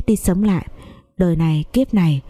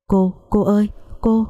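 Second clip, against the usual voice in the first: alarmed cry for help; mild panic calling out; panicked call; 3 semitones higher than usual. Acted. Lâm calls from the sickroom in alarm. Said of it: "có tiếng con Lâm đầy hốt hoảng".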